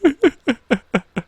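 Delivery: high-pitched laughing fit; high giggle. Real, not acted. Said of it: "Kinda giggles".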